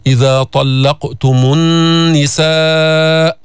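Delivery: slow chant, النساء with a held nasal ن and long final vowel; ghunna and long madd. Shown as a demonstration. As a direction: recite slowly, humming the ن of النساء. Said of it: "غنة بمقدار حركتين". (ترتيل بغنة ومد في النساء)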